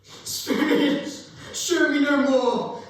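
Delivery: high, loud and desperate, voice pushed up; distraught pleading. Acted. Scrooge pleading with the Spirit, high and desperate. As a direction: beg to be released from the visions.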